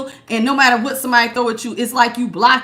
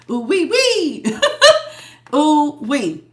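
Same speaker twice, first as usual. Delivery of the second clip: giggling through the ooh-wee; laughing; 3 semitones higher, slower than usual. Real, not acted. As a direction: laugh while you say it. Laughing at a bad reading.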